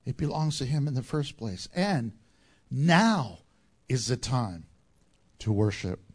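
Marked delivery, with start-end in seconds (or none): 1.7-6.0 hushed, reverent invitation. Real, not acted. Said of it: "preacher slows into worship call".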